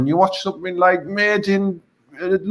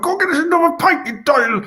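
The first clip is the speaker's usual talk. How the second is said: mock shout; 11 semitones higher than usual. Retold shout in pub.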